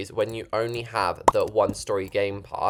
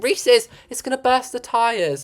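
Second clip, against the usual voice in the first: worried viewer; 11 semitones higher than usual. Retold or imitated. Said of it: "you think".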